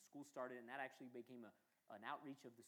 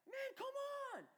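high, loud, exasperated yell; hammed-up shout of frustration at heaven; mock outraged cry to God; retold yelling at God; 11 dB louder than usual. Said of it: "I'm yelling at God".

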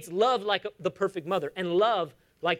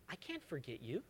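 very soft, low voice of God; hushed, lower and tender; tender reassurance; 6 semitones lower, 18 dB quieter than usual. Acted. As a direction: reassure them very softly. God reassuring His people, voiced.

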